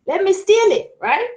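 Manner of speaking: high, loud greedy bandit voice. Acted. Mother voicing a bandit to Eshu, high and loud. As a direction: pitch it up like a thief spotting loot. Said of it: "look at the calabash and say".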